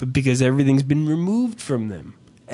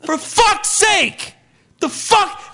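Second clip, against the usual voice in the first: high, shouted, furious outburst about the boxes; escalating rant. Retold or imitated. To his wife, acting out how the calm chat turns into yelling. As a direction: blow up, yelling higher and higher. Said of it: "it goes like".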